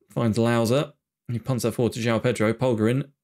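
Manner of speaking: normal talking voice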